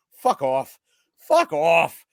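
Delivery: usual speaking voice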